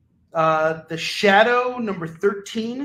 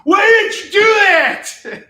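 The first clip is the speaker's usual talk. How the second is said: much higher, much louder angry shout; mock gamer rage, laughing through it; kids yelling; 12 dB louder, slower than usual. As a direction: scream it like a furious gamer kid. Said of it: "yelling and screaming at each other".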